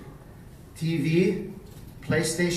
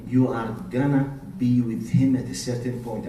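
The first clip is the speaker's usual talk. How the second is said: ordinary delivery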